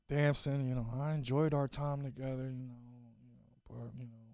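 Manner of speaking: low-key, hesitant goodbye; Lori Harvey. Acted. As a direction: let him down gently, trailing off. Acts Lori addressing her ex.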